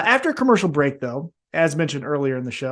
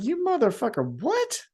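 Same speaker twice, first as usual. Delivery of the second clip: much higher, disbelieving mock outrage; mock shock; 8 semitones higher, 5 dB quieter than usual. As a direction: react as the stunned interviewer. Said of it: "Renee Paquette's like".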